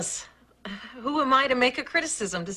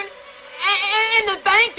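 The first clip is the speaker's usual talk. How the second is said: ordinary talk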